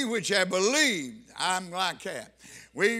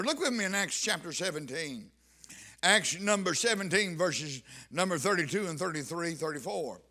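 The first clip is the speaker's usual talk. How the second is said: ordinary talk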